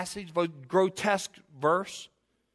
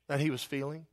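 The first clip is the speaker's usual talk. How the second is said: usual speaking voice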